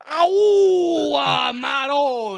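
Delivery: loud hammed-up Italian cry; over-the-top Italian outburst; mock Italian exclamation. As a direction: shout it like an Italian chef. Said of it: "'cause we go".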